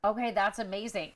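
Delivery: ordinary delivery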